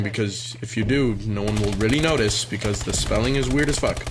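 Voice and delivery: normal talking voice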